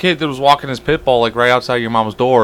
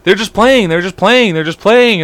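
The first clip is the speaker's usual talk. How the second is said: put-on soothing child; 10 semitones higher than usual. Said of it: "And I'm like".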